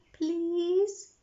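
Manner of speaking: put-on high begging little girl; pleading little girl. A narrator's reading. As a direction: beg sweetly, up high. Peppa pleading.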